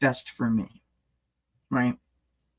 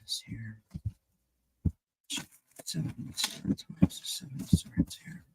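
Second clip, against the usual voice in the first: hushed whisper to the cards; 2 semitones lower, 10 dB quieter than usual. Real, not acted. Asking the tarot deck about the Seven of Swords, whispered.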